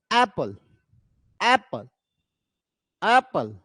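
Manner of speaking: Apple with the opening ऐप stressed, पल left light; stress on first syllable. Shown as a demonstration. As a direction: demonstrate Apple word by word, weight on ऐप. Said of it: "ऐप पे जो है ज्यादा जोर देते हैं". (Apple के पहले हिस्से ऐप पर ज़ोर)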